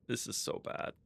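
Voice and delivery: soft, voiceless whisper; whispered dismay. Real, not acted. Player facing own mess, dismayed whisper. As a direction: say it under your breath.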